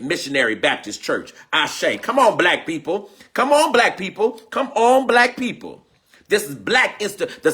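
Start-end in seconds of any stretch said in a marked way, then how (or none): 2.0-4.3 rousing call. Real, not acted. Rousing the congregation. 4.5-5.9 building up, higher and louder; rousing call. Real, not acted. Peak of the call.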